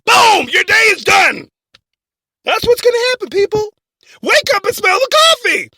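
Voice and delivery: loud, high-pitched alarmist rant; shouted warning. Acted. Host warns listeners about what's coming, shouted warning. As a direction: holler it to wake them up.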